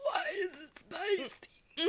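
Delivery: slow, high, eerie possessed wail; demon voice. Shown as a demonstration. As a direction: drag it out like a possessed spirit. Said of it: "That was perfect".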